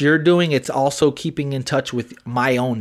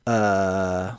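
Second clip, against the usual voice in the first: mock dull girlfriend; 7 semitones lower, 7 dB quieter than usual. Mocking the girlfriend's indifferent reply, low and bored. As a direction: drag it out low and uninterested. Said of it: "she's just like".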